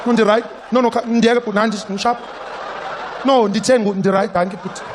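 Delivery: put-on loud high shouting in South African accent; shouting woman, thick South African accent. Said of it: "This woman will be there like".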